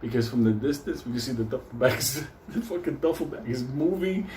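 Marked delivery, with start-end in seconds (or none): none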